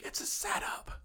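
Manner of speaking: paranoid whisper; breathy mock paranoid whisper. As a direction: hush it like a conspiracy. Drunk paranoid warning to friends.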